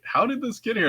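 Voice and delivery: high, puzzled wondering. Retold or imitated. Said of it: "I'm like".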